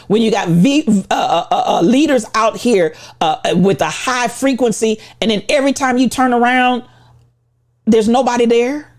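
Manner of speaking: ordinary talk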